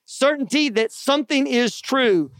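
usual speaking voice

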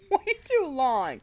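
laughing. Real, not acted. Admits overthinking, laughs.

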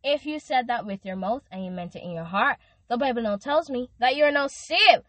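normal talking voice

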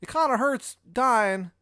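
high, loud, whining complaint; pained Shithead Steve. Acted. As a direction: whine in a put-on high Maryland voice. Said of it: "I was like".

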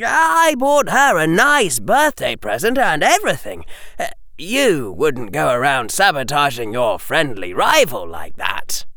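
high, innocent schoolboy; Harry voice. Acted. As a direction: play the innocent.

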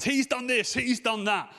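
lightly voiced children telling on each other; a hint of childish blame-shifting; squabbling kids. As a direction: pitch it up a little, like brothers accusing each other. Voicing his sons' squabbling accusations.

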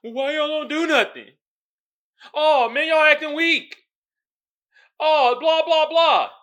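very high, loud jeering caricature; hammed-up loud heckle; mock taunters. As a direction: mimic the critics in a shouted high-pitched whine. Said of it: "try to spin and be like".

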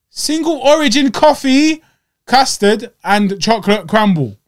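fuming reading of the menu; enraged ranting. Retold or imitated. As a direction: spit out each menu item in rage. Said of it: "I'm spit talking 'cause I'm enraged".

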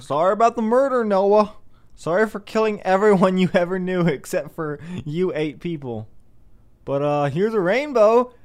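high, loud hammed-up God apologizing; mock God voice. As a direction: play God sheepishly saying sorry, hammed up.

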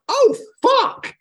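very high, drawn-out exclamation; hammed-up high-pitched astonishment; retold amazed outburst. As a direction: re-enact a startled moment of recognition. Retold reaction on seeing the video.

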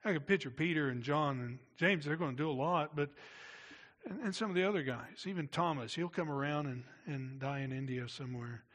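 normal talking voice

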